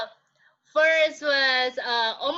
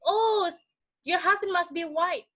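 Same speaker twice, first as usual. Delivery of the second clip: high mock cooing over the baby; imitating gushing elders; 8 semitones higher than usual. Elders praising the pale baby, high mock gushing. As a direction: coo like doting elders. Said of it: "they're saying, like".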